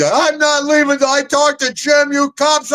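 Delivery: high, slow defiant insistence; wife defying the cops at the station; feisty wife refusing. Retold or imitated. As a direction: dig in and refuse to budge. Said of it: "Deborah says".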